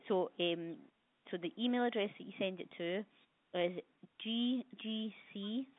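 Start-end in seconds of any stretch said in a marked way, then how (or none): 4.2-5.7 slowly spelling out letters; slow dictation. Real, not acted. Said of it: "Golf Golf Charlie".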